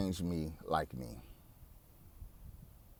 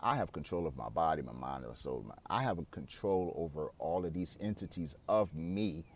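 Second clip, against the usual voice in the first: low, soft and steady self-command; firm inner command; 6 semitones lower, 6 dB quieter than usual. Pretend. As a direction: calmly take back control of your mind. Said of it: "you're telling yourself that".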